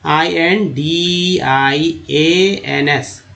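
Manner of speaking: letter by letter, slow and measured; slow classroom spelling with gaps between letters; spelling out slowly. Shown as a demonstration. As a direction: spell it out, each letter held. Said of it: "कैसे लिखते हैं Indians".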